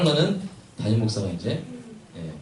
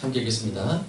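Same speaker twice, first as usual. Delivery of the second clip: ordinary talk, nothing marked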